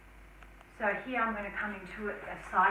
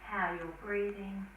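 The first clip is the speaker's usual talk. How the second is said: slow, soft guided breathing; calm breath cues, trailing to whisper; guided meditation; 7 dB quieter, slower than usual. Acted.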